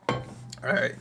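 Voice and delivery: ordinary delivery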